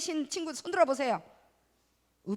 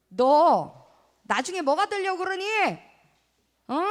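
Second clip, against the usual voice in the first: scolding mom voice, raised and loud; nagging parent; 3 semitones higher, 6 dB louder than usual. Acted. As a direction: nag like an exasperated mother. Children's sermon, acting nagging parent. (잔소리하는 엄마 목소리)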